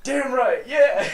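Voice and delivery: high, loud cocky cheer; drunk gloating. Retold or imitated. Drunk teen gloats after push-ups, high loud cocky cheer. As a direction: crow about winning.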